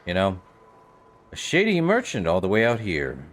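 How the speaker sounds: ordinary talk, nothing marked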